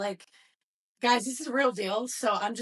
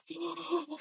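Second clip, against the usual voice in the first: sharp gasp; 7 semitones higher, 7 dB quieter than usual. Retold or imitated. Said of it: "I was like".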